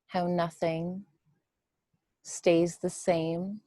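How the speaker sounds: measured meditation voice with long, spacious pauses; guided meditation. Real, not acted. Teacher guiding listeners to witness the breath, guided meditation. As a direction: guide them gently through the breath, leaving room between phrases.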